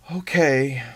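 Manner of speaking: plain reading voice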